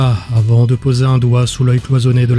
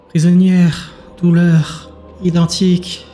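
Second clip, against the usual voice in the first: higher mind-voice, one word at a time; a sympathetic lament in isolated words; gentle, plaintive creature; 4 semitones higher, slower than usual. Acted. Creature shares its pain with Azur, gently plaintive. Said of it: "La voix douce était muée en une sorte de complainte".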